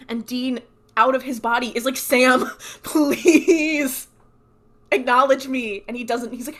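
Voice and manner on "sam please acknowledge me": loud, high, hammed-up begging; desperate pleading. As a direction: imitate begging someone to notice you. Ghost Dean begs to be heard.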